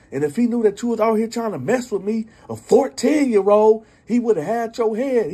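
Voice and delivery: high, loud furious rant; angry outburst. Retold or imitated. Fourteen-year-old girl telling off her dad's friend, high and furious. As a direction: tear into him, voice up high. Girl yelling at creepy uncle.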